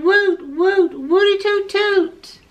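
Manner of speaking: high, drawn-out hooting cheer; cheering cry. A narrator's reading. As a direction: hoot a high celebratory cheer. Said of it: "they cried".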